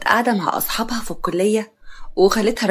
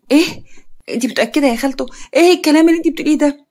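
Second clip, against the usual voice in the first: higher-pitched startled disbelief; shocked; 5 semitones higher, 4 dB louder, much faster than usual. Acted. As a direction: burst out in shocked, rushed questions. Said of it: "يارا بصدمة".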